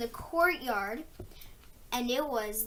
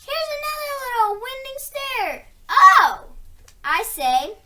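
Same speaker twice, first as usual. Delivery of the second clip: high eager exploring voices; lively calls from the castle rooms; excited calling out; 6 semitones higher, 8 dB louder than usual. A narrator's reading. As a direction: shout finds to each other. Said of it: "crying out at once".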